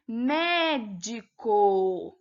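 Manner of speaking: "Médico" very slowly, with MÉ held long; stress on MÉ. Shown as a demonstration. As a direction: draw out the MÉ in "Médico", syllable by syllable. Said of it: "A sílaba tônica é o mé".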